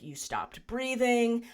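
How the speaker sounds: mild mock panic, drawn out; imitating a freaked-out partner. Partner recalling she stopped breathing, mildly panicked. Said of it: "like, freaking out because he's like".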